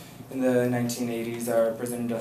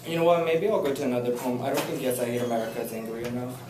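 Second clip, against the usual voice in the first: normal talking voice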